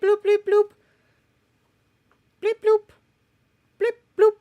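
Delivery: high bleep-bloop noises; computer bleeps. Retold or imitated. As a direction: chirp like a machine. Computer sounds.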